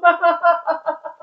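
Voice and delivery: long, high run of giggles; giggling fit. Real, not acted. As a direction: keep giggling helplessly. Laughing about the slip.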